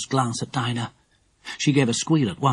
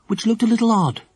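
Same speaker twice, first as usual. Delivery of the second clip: ordinary delivery, nothing marked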